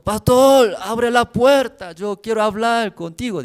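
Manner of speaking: put-on haul the words out like a drunk banging on the door; drunken shout at the door.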